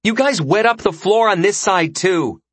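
put-on high-pitched girl's complaint, called out; indignant yelling. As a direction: scold them loudly through a wall. Gilly complains loudly through the partition.

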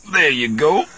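strained grunting words; grunted. A narrator's reading. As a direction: say it mid-lift, straining. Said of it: "Lando grunted".

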